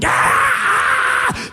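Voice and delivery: very high, loud crazed shriek; deranged scream. Acted. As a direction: scream out like a madman. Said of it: "old grizzled fifty-something-year-old psychotic men".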